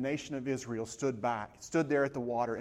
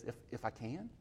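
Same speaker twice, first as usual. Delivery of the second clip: soft gentle echo; 2 semitones lower, 12 dB quieter than usual. Retold or imitated. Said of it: "the Lord looks at him and he says".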